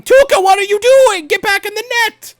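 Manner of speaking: high, loud frantic yelling from the bench; shrill mock panic yelling at the goalie; imagined screaming players. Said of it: "all of the players screaming".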